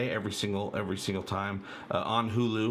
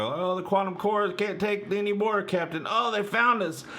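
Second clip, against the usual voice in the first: higher, lightly hammed-up panicked officer; mock battle-stations crew; 9 semitones higher than usual. Fan parodying generic starship battle dialogue, mock alarm. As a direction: toss off stock sci-fi alarm lines. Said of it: "all the regular".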